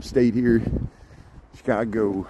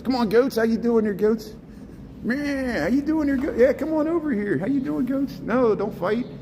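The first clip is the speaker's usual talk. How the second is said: much higher, playful voice for greeting the goats; talking to the goats; 10 semitones higher than usual. Pretend. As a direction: chat up the goats in a high, goofy voice. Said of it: "Let's converse here in goat speak".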